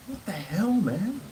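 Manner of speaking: high, outraged what the hell man; a retold rebuke to the dog owner; indignant retort. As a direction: challenge the owner in disbelief. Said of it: "I was like".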